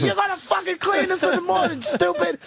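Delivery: high, hammed-up bellow; imitating a furious buddy yelling about his car seat; mock yell. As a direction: yell it like an outraged friend at 2 a.m. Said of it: "at the top of his lungs, he's yelling".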